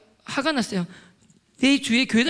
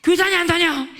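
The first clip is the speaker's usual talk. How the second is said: loud, high shouted question; angry interrogation; 6 semitones higher, 6 dB louder than usual. Retold or imitated. Interrogating classmates about church, retold angry shout. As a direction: fire the question at them, raised and forceful. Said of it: "엄청 욕을 했어요".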